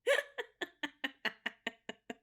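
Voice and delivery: long staccato laugh; real laugh. Real, not acted. Host amused at feature drinking the sick water, real staccato laugh. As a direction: laugh out in a run of short bursts.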